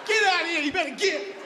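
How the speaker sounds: high-pitched shooing yell; shooing cats. Acted. Comic shooing unattractive people like cats, high shout. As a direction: chase off a stray cat with your voice. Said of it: "I treat 'em like fucking cats".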